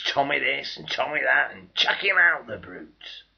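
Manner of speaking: loud scornful jeer; contemptuous shout. Acted. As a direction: bark it like an angry crowd. Civilians throwing soldier out.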